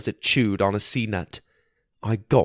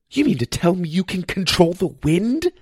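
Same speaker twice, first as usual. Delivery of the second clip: high-pitched amazed question; incredulous astonishment; 9 semitones higher than usual. A narrator's reading. Apprentice stunned that the teacher controls wind, incredulous. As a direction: ask in wide-eyed disbelief, pitch climbing.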